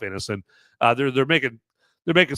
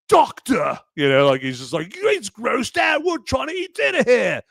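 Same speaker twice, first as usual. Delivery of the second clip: mock Keanu with a much higher, bad English accent; lousy English accent; 11 semitones higher than usual. Mocking Keanu at the dinner scene, bad English accent. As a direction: ham up a terrible English accent, squeaky and indignant. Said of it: "that lousy English accent that Keanu tried".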